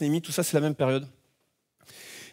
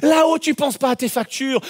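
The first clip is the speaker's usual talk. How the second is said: ordinary delivery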